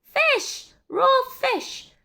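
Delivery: very high, loud shriek; screaming at raw fish in the cake; disgusted scream. A narrator's reading. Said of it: "she screamed".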